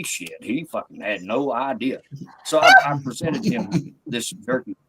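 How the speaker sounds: ordinary talk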